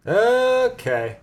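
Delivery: high, loud sarcastic okay. Real, not acted. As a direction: toss off a squeaky skeptical okay. Sarcastic reply to the mom remark.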